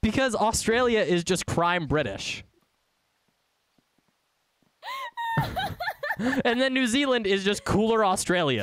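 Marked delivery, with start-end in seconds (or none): 4.8-6.4 real laugh shooting way up high; high shrieking laughter. Real, not acted. Cracking up at accent joke.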